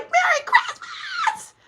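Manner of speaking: very high, drawn-out squeal with laughter; joyful scream. Retold or imitated. Retelling her happy outburst alone in the car, screaming. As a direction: re-enact an ecstatic scream, stretching it out. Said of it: "I was about to scream".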